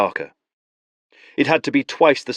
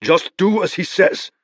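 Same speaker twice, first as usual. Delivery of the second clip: curt growl; a touch of a growl; 10 semitones higher, 3 dB louder than usual. Acted. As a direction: growl it at him to shut up. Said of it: "Palamedes growled".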